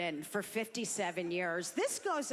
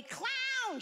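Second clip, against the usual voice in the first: very high-pitched imitated cry; mock heckler yell; 14 semitones higher than usual. Old woman heckles the comic, mock yell. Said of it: "One woman once yelled out at me".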